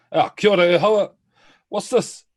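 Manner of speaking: high, loud, cheery traveller's hail; character greeting. Acted. Two greets the sentinel and asks, high and loud. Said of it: "and be like".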